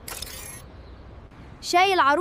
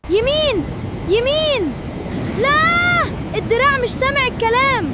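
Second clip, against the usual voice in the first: frantic calling; 8 semitones higher, slower than usual. Acted. Frantic directing the robot arm.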